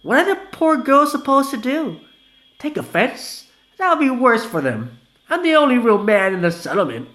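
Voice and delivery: put-on high, loud tipsy young guy. Vanilla boasting about the settlement, high loud voice.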